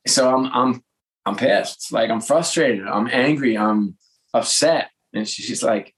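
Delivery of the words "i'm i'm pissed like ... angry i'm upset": normal talking voice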